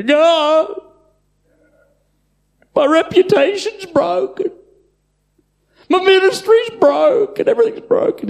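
high, loud, whiny complaint; whining plea. Pretend. Believer complaining to God about everything broken, whining. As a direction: wail it like a self-pitying child.

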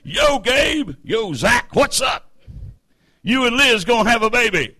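high, excited buddy-to-buddy call; boisterous, high-pitched slangy greeting; hearty American banter. Acted. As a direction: play two pals hailing each other loudly. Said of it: "Act American".

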